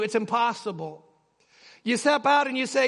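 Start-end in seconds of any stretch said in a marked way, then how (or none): none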